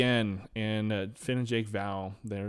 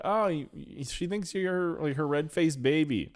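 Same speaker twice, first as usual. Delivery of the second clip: high, louder teasing Jake voice, laughing at the end; a lightly voiced Jake, pitched up and giggling; Jake imitated; 7 semitones higher, 4 dB louder than usual. Jake teasing Finn, in a high mock voice. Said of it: "he's like".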